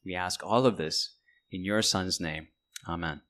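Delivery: low, soft praying voice; hushed, measured prayer to God; closing prayer. Real, not acted. Closes a prayer.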